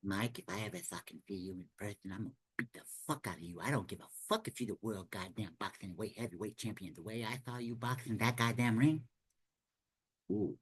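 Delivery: quiet, soft mocking boxer voice; mock the champ's soft threatening voice; hushed, taunting Mike Tyson send-up; Mike Tyson impression. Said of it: "Yes, I'm making fun of your voice".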